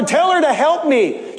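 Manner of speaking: "tell her to help me" said as a command; bossy demand. Shown as a demonstration. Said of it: "not asking for help".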